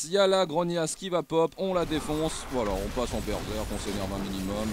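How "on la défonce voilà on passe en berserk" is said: ordinary talk, nothing marked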